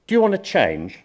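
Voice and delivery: loud, high, pointed question; blunt challenge. Acted. Expert to a client, blunt challenge. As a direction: put the question forcefully, pitched right up.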